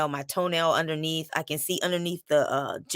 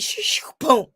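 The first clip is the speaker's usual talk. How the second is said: high whooshing swoosh then a boom, mimicking the falling block; playful sound effect of a block crashing on a toe; whooshing crash noise; 7 semitones higher than usual. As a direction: act out the block flying down and landing. Block falling at full speed.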